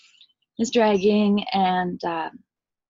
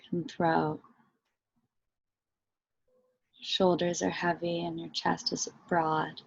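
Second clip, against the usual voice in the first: guided meditation; slower than usual. Real, not acted. Teacher leading listeners into body and breath, guided meditation.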